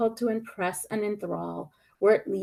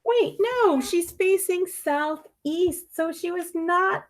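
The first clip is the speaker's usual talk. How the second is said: sudden correction; 8 semitones higher, slower than usual. Acted. Narrator corrects himself.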